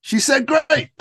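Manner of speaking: upbeat mock-quoted enthusiasm; retold delighted yes. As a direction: accept eagerly, pitch jumping up. Retold eager yes to an invitation.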